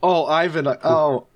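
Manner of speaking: normal talking voice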